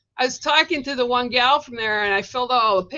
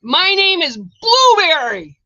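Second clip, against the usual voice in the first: high, loud, slow mock voice announcing itself; a high, loud, slow voice from nowhere; a loud high voice calling from nowhere; mystery voice; 7 semitones higher, 10 dB louder, slower than usual. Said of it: "and I hear".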